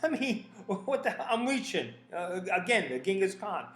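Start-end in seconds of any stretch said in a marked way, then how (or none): 0.0-1.9 high, laughing shrug of a reply. Retold or imitated. Joking with the interviewer, laughing off the question. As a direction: laugh it off, playful and easy.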